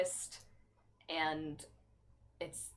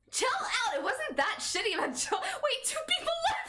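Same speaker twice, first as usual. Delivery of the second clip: high, louder shouted protest; high exasperated shout; 4 dB louder than usual. Real, not acted. Streamer yelling at chat as viewers leave, high. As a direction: yell at chat in disbelief.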